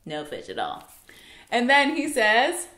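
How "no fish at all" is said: very low, subdued reply; dejected fishermen. Retold or imitated. Fishermen answer the man after a fruitless night, dejected. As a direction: drop the voice right down, glum.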